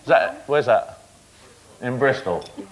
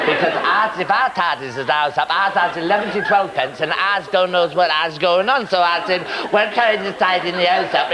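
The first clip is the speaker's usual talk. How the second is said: hammed-up West Country burr, pitched higher; mock Bristol accent; 5 semitones higher than usual. Host mimics the child's Bristol accent, teasing mock West Country. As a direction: parrot the child's Bristol speech for laughs. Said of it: "I have a slight problem with the accent".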